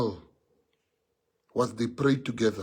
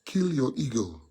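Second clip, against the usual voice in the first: ordinary talk, nothing marked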